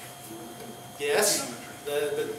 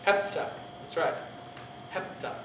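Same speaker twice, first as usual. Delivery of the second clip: usual speaking voice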